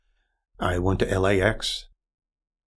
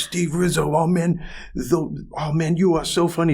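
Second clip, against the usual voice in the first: gushing fan; 7 semitones higher than usual. Retold or imitated. Man gushing over recognized comedian.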